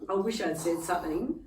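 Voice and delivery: ordinary delivery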